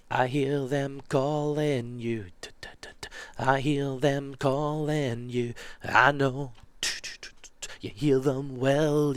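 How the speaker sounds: slow, whispery Northern Scottish singing; slow breathy Scottish folk song voice; Northern Scottish accent. Shown as a demonstration. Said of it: "I'm putting on a voice for that place".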